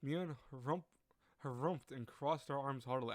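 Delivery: usual speaking voice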